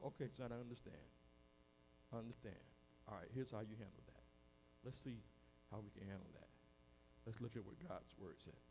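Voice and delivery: very soft, low, calming talk; understanding father. Acted. Father sitting down to hear his son out, soft and understanding. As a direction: reassure him quietly and gently.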